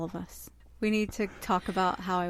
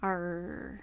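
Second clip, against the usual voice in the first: pirate growl; 4 dB quieter than usual. Pretend. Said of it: "I gotta talk like a pirate".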